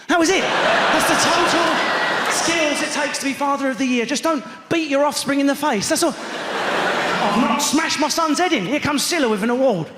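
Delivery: laughing rant. Real, not acted. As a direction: rant high and loud, cracking up. Comic rant peaking loud, laughing.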